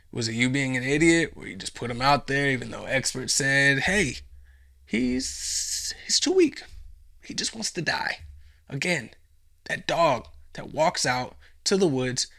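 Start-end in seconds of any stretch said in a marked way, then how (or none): 3.8-8.2 squeaky high put-on voice, fading to a breathy, voiceless end; shrill, worried fake warning; mock expert warning.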